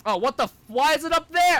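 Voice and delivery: high, loud cry of disbelief at the game; exasperated outburst. Real, not acted. Gamer yelling at an unreachable item, exasperated. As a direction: yell up at the ledge, fed up.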